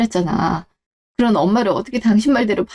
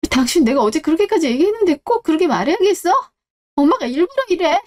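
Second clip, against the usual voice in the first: angry; 6 semitones higher than usual. Acted. Wife confronts husband at table.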